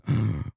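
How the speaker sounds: sharp startled gasp; demonstrated gasp. Shown as a demonstration. Host showing how she shot up in bed, startled gasp. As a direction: gasp as if jolting awake. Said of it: "like that".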